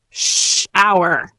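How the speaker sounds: "Shower" with a long drawn-out "Sh". Shown as a demonstration. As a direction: stretch "Shower" out, opening sound held. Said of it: "a little slower".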